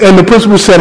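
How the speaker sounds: normal talking voice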